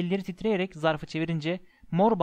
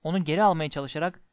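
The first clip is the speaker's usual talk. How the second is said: ordinary delivery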